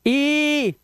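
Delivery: ई held long and high; long vowel. Shown as a demonstration. Teacher contrasts the sounds for students, long ई. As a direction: sustain ई as a long ee. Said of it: "यही आवाजें हैं".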